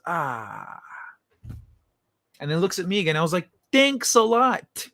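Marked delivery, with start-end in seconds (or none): 0.1-0.8 soft odd cat voice; voicing the cat. 3.7-4.9 high, slow, exasperated sarcasm; sarcastic thanks. Retold or imitated. Mouse escaped into clothes.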